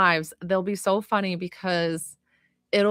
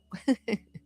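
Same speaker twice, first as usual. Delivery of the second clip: a quick real giggle at the name; can't hold back a laugh; helpless giggle; 3 semitones higher, 5 dB quieter than usual. Real, not acted. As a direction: burst of giggles over a funny name. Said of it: "I can't say it without laughing".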